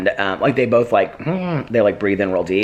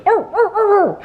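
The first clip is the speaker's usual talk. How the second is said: very high, louder yappy barks; imitated dog barks; 4 dB louder than usual. Owner imitating her small dog's barking with high yaps. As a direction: yap like a tiny dog. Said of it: "he goes".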